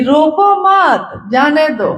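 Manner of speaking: slow रोको मत as one group, break, then जाने दो; misplaced comma. Shown as a demonstration. Guru brother misreports Sahab's order, pausing after मत. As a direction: pause after मत, not after रोको. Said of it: "comma भूल गया वो".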